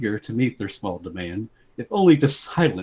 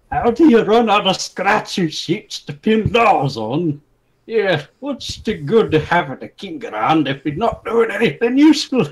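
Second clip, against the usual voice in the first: furious complaint; 9 semitones higher, 5 dB louder than usual. Acted. Brawlers loudly berating the king, indignant. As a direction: rant at the king for failing. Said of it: "talked over each other furiously".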